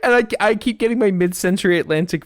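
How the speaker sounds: loud carnival barker. Acted. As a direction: pitch it like a showman at a fair. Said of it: "You sound like a carnival barker".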